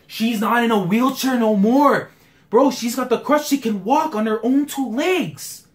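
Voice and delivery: joyful realization; loud hype building; thrilled fan. Real, not acted. Excited at character walking again.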